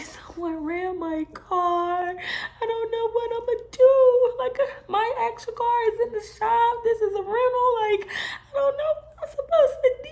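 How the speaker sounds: mock tearful, high and pleading; retold crying. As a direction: whine it out like she was crying then. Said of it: "I was just crying".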